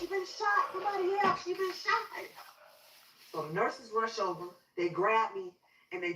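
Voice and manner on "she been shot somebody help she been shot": high, urgent plea for help; retold frantic high cry; panicked cry for help. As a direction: call out desperately for rescue, voice pitched way up. Cousin carries her in, calling for help.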